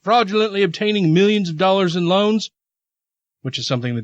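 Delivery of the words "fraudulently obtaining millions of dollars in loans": higher-pitched mock Southern accent; put-on Southern accent. Host quoting the attorney's statement, put-on Southern accent. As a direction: read it as a Southern official. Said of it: "you did that accent".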